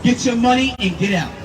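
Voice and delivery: ordinary talk, nothing marked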